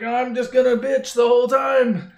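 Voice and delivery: loud, high mock whine; C-3PO whining. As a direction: complain like a put-upon robot. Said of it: "C three P O is like".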